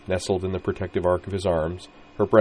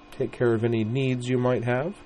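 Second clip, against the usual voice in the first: higher coy suggestive offer; flirtatious; 5 semitones higher than usual. Acted. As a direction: tease him with a sly hint. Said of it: "batting her thick eyelashes at him".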